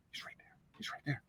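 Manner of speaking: unvoiced urgent whisper. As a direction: hiss it so he can't hear. Ex-wife points out follower in elevator.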